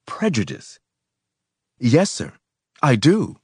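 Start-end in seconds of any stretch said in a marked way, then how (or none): 1.8-3.4 raised, higher-pitched angry retort; indignant comeback. A narrator's reading. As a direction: answer back hotly, voice lifting. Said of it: "I said angrily".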